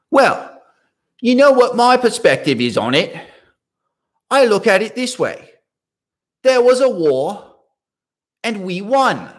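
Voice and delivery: put-on a wealthy Australian friend shrugging off history in a posh accent. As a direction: mimic a wealthy Australian with an English-sounding accent. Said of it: "I know I'm not doing his accent justice".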